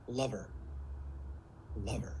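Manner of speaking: low, soft and unhurried; lover voice. Shown as a demonstration. Coach introduces the third range, lover voice. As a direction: drop low and soft, leave space. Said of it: "It's where I slow down".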